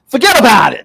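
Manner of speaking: high, loud mobster catchphrase; Tony Soprano. Acted. Radio host playing at a mobster co-host, exclaimed Tony Soprano impression. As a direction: belt it out like a TV mobster. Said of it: "Tony Soprano, um, is co-hosting the show".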